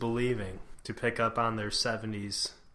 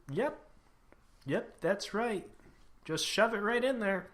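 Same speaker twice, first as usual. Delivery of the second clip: oblivious forties voice; 9 semitones higher, 4 dB quieter than usual. Pretend. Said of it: "people just be like".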